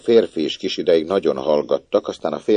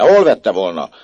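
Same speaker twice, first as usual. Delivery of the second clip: much louder, higher accusing shout; angry shout; 8 semitones higher, 11 dB louder than usual. Acted. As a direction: yell thief at the child. Mother accuses the child. (dühös kiáltás)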